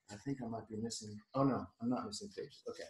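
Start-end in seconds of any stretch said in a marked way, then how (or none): none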